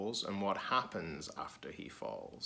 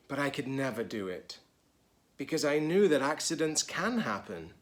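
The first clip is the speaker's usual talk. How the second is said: lively, higher storybook reading voice; reading to children; 4 semitones higher, 4 dB louder than usual. A narrator's reading. Teacher reading a picture book to children, lively. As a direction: read it brightly to the kids.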